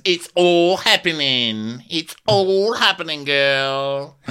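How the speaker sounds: high, drawn-out theatrical delivery; mimicked campy man. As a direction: imitate him milking each word with delight. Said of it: "And he goes".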